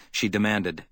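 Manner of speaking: plain reading voice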